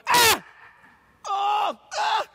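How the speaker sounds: high loud scream; car crash scream. Retold or imitated. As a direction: scream at the impact. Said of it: "You go".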